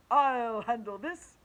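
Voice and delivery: put-on teacher announcing himself to kids, booming; superhero declaration. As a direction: proclaim it like a comic-book hero. Teacher heroic line.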